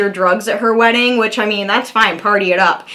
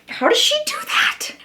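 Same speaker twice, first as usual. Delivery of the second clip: hushed, high, voiceless wonder; mock whisper; 6 dB quieter than usual. Retelling her own reaction to Amber, a high awed whisper. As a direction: breathe it out in disbelief. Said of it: "she's like".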